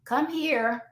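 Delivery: soft, restrained call; not yelling. Acted. Parent calling a child who can hear, not yelling. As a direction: call the child over without raising the voice. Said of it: "why are you yelling?".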